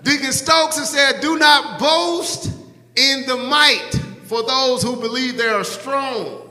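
high, ringing pulpit proclamation; preaching. Real, not acted. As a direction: call it out to the whole church. Preaches at raised pitch.

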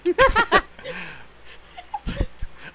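loud, high laughing cry; high squealing laugh. Real, not acted. Laughs at own mistake.

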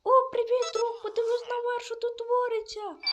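high, loud character voice pointing upward; excited villager greeting. Acted. Another character greets the friend. (високий гучний голос персонажа, що кличе друга)

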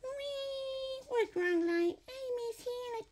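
soft, high pretend whimper; tiny mock-tearful animal voice; crying toy lion. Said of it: "Lion go".

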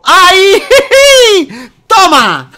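loud high triumphant cries; excited shout. Real, not acted. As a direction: cheer and laugh in triumph.

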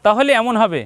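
usual speaking voice

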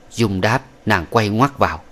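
usual speaking voice

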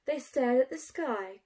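ordinary delivery

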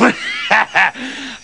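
short bursting cackle; evil farmer's parting cackle; villainous laugh. Acted. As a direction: cackle as you leave.

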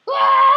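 high, loud hammed-up scream of a shot player; loud high pretend scream; mock scream. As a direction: act out being hit by an arrow. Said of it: "He would be going like this".

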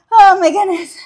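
breathless gasp after the set; panting through the words; out of breath. Real, not acted. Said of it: "Talk about a kettlebell swing cardio workout".

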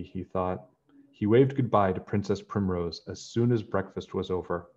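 ordinary delivery

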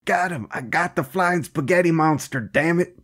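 high-pitched mock triumph; triumphant cop. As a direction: crow like a backwoods cop bagging a monster. Acting triumphant cop who shot monster.

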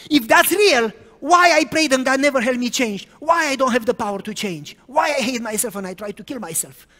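loud, high anguished questions, easing; girl crying out why God never helped; desperate outburst. Retold or imitated. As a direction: hurl the questions in despair.

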